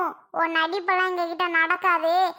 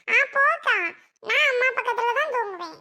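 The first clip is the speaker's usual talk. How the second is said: put-on high childish voice, whiny and possessive; a small boy clinging to his mother's side; little boy voice; 6 semitones higher than usual. Little boy refusing his sister a place, high childish whine.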